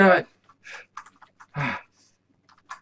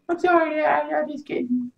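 lightly voiced kid saying sorry; sheepish kid; 13 semitones higher than usual. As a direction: back down like a caught child. Son apologizes to dad.

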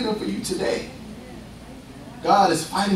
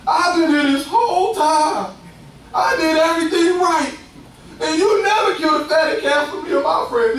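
put-on mad older son; 7 semitones higher than usual. Preacher plays the prodigal's jealous brother, hammed-up anger. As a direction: sputter the older son's jealous complaint. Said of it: "he mad with the daddy".

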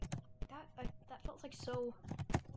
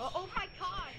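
real alarm; startled; 8 semitones higher than usual. Real, not acted. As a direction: react to a jump scare. Startled in game.

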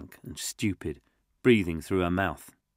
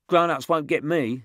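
put-on little tough kid; 9 semitones higher, 6 dB louder than usual. Josh boasting to the group, high and loud. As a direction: brag like a scrappy kid. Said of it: "said Josh".

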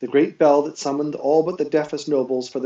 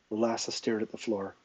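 usual speaking voice

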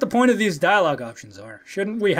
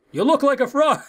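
teasing character voice; 7 semitones higher than usual. Acted. Bodyguard's green outfit being mocked, high teasing voice. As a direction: tease in a raised, hammed-up voice.

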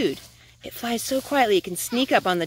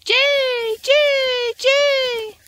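high, loud squawking bird call; blue jay squawk; 6 dB louder, much slower than usual. A narrator's reading. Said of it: "The blue jay squawks its name".

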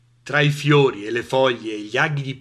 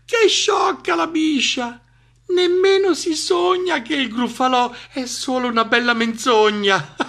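gleeful mockery; 11 semitones higher, 4 dB louder than usual. Mouse laughs at the gullible snake, gloating. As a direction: gloat over the fooled victim.

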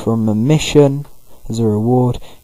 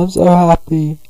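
mock happy crying; 7 semitones higher, 3 dB louder than usual. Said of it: "So Bidoof is crying".

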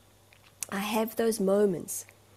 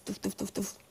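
soft, rhythmic punch noises; imitated punching thuds; 7 dB quieter than usual. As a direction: imitate thumping a pillow. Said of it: "that I punch for two minutes".

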